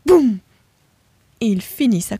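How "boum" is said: loud, higher punchy "boum"; crash sound word. A narrator's reading. Crash narrated with flair.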